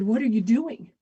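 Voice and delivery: loud, frantic urgency; old father terrified of the law, loud and frantic; panicked. Acted. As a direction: beg him to take you back. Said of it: "and panicking, he said".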